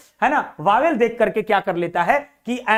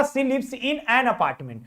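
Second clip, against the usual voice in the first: right answer read very slowly; slow reading aloud; 4 dB quieter, much slower than usual. Shown as a demonstration. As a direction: spell out the correct sentence. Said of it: "right answer होगा". (सही उत्तर को बहुत धीरे पढ़ना)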